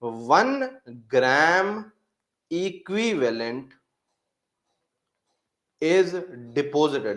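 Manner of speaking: very slow dictation with pauses. Shown as a demonstration. Teacher dictating what students should write, very slowly. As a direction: spell it out word by word for the notes. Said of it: "हम ये लिखेंगे".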